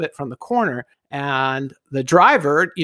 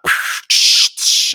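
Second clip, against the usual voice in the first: loud hissing air-brake noise; imitated truck brakes; 4 dB louder than usual. Storyteller showing the trash truck pulling up, imitated hiss. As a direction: mouth the hiss of a truck stopping.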